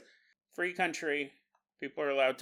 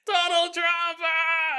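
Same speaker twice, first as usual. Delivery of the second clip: much higher, loud mock yell; hammed-up witch shriek; ritual shout; 13 semitones higher, 6 dB louder, much slower than usual. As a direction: bellow the name like casting a curse. Roommate shouting spell in living room.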